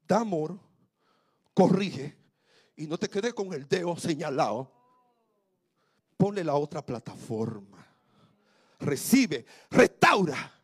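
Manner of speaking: loud, rising pulpit cry; preaching at full force. Acted. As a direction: build it up to a shout. Preaching climax.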